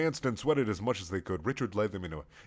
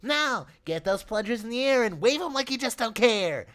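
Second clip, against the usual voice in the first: high, bubbly dance caller; excited party host; 12 semitones higher than usual. Acted. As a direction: hype up the crowd. Richard leads the dance.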